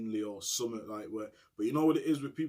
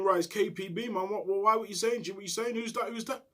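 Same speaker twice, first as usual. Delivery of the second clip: retold call back; 8 semitones higher, 3 dB louder than usual. Retold shouted exchange.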